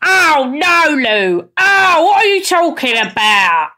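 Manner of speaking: high, drawn-out scolding mum voice; her mum. Acted. Daughter mimics mum telling her off, high exaggerated mum voice. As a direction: stretch it out like a dismayed mother. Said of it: "That's my mum".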